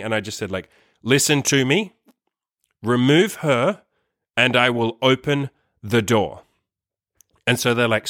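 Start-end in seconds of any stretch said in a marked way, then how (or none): 1.0-6.4 slow, deliberate words with pauses between; calm, direct, serious. Retold or imitated. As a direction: lay down a measured ultimatum, word by word. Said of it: "very calm but very direct and serious voice".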